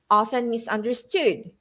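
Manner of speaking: ordinary talk, nothing marked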